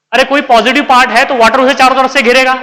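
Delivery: ordinary talk, nothing marked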